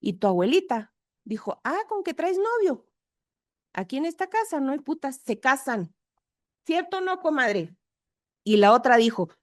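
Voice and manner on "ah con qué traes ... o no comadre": high mock-stern old grandmother; scolding grandmother. Grandmother confronting the daughter about her boyfriend, mock-stern and high. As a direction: scold them like a strict abuela.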